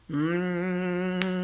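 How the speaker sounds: long, steady nasal hum; strange humming. A narrator's reading. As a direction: hum on one note as if entranced. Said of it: "a strange humming came from his nose".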